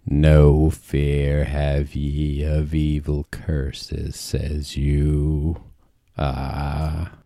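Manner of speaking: low, slow pirate growl; a slow old sea dog's threat. Acted. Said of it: "That sounded like a bloody pirate".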